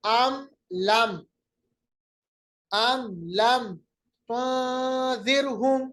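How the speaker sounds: slow recitation, meem in अम and लम said clearly; tajweed teacher demonstrates izhar. Shown as a demonstration. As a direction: close the lips on each meem, no nasal hum. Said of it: "मीम दोनों पे इजहार".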